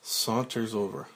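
usual speaking voice

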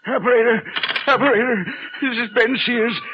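high, loud panicked calls into the phone; frantic shouting. Acted. Old man yelling into a dead phone line.

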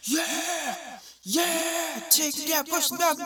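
high, loud mocking shouts; crowd egging on. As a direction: yell like a hyped crowd. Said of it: "riding around like".

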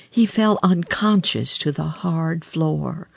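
usual speaking voice

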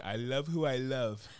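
slow, drawn-out mock declaration; slow mocking quote. Mocking people who defend a partner, slow proud slogan. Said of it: "people be saying".

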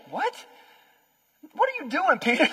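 high, disbelieving mock question; incredulous disciple. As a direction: act baffled at your friend. Disciples react to Peter.

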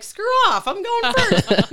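hammed-up road rage; mock aggressive yell. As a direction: bark at the driver cutting in, hammed up. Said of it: "I'm like".

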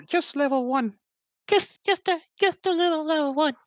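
excited. Real, not acted. Excited pitch jump on level one.

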